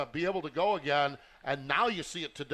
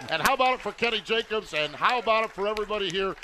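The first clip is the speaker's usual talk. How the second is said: loud, higher hype announcer voice; announcer sign-off; 6 semitones higher, 6 dB louder than usual. Acted. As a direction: pump up the crowd on the mic. Host signs off the broadcast.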